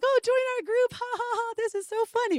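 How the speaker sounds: high, giggly mock cheer; mock laughing. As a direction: laugh it off like friends teasing. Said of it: "we were like".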